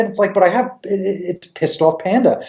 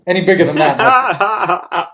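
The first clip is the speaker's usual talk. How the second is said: slow, laughing through the words; slower than usual. Real, not acted. Comic riffing on a joke product, laughing. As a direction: crack up at your own joke.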